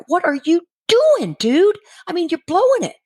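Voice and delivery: silently yelling at him to fail; exasperated disbelief. Retold or imitated. Wife urging husband behind doctor's back, exasperated. Frantic at husband failing test.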